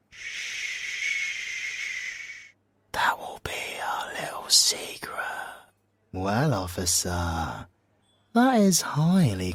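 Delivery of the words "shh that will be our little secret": soft hushing whisper, quick on the secret; conspiratorial whisper. Acted. Sharing a secret with the officer, playful whisper. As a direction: hush him and lean in with the secret.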